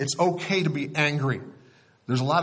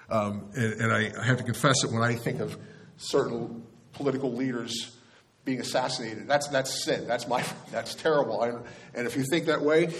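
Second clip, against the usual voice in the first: ordinary talk, nothing marked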